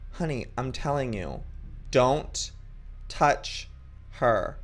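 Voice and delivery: "don't", "touch", "her" each set apart by a pause; firm mother, full stop after each word of "don't touch her"; stern warning. Acted. Mother firmly warns daughter.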